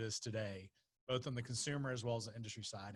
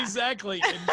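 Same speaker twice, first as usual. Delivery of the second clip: laughing; 8 semitones higher, 11 dB louder than usual. Real, not acted. Laughing agreement, loud.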